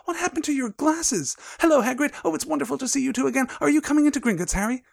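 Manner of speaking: put-on high-pitched eager girl character; excited greeting. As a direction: gush questions in a high voice. Reads Hermione greeting her friends.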